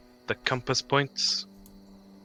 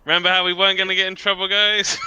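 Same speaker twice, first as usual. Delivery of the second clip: excited teasing; 6 semitones higher, 7 dB louder, faster than usual. Real, not acted. Combat starts, teasing the group.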